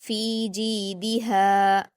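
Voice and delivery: फी जीदिहा chanted slowly, long फी, जी and हा, short दि; stretched madd. Shown as a demonstration. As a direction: hold every long vowel of फी जीदिहा but दि. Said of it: "दो हरकत खींचेंगे".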